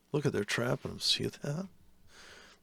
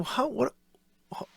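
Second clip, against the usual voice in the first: ordinary talk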